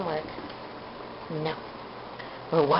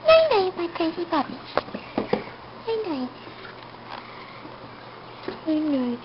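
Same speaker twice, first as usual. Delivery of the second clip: soft goodnight; 4 semitones higher, 7 dB quieter than usual. Real, not acted. Bidding puppy goodnight softly.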